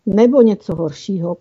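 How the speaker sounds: ordinary delivery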